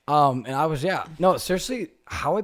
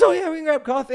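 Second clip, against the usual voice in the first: high, loud mock-eager girl; perky girl imitation; 13 semitones higher, 6 dB louder than usual. Voicing a keen girl in a story.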